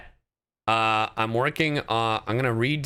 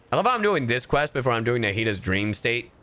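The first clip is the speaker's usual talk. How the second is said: normal talking voice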